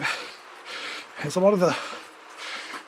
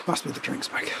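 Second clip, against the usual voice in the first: whispered aside; 2 semitones lower, 10 dB quieter than usual. Real, not acted. Muttered to himself.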